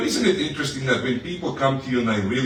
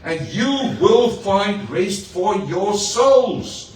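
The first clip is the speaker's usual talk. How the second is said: slow tender promise; 7 semitones higher, 4 dB louder, much slower than usual. Acted. Quoting Jesus slowly.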